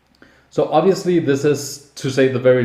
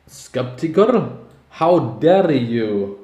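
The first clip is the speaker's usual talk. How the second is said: mock Russian accent; slower than usual. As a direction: huff in a hammed-up Russian accent. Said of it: "I can't do a Russian accent".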